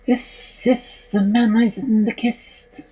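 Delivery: breathy, slow whispered call; eerie whisper. A narrator's reading. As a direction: hiss it like a ghostly voice. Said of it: "called out for the third time".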